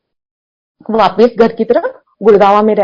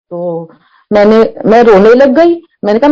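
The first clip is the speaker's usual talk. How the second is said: usual speaking voice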